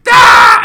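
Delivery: sudden unvoiced loud yell; startled shout; loud gasp cry. Real, not acted. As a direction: burst out a sharp cry. Reacting in game battle.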